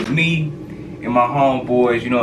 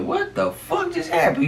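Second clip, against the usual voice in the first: much higher, slow disbelief; high baffled. Retold or imitated. Baffled after cops let them go, high disbelief. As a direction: squeak out your disbelief.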